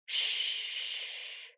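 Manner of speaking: long, soft hush; soothing shush. A narrator's reading. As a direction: shush as if rocking a baby. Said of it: "rocking her and saying".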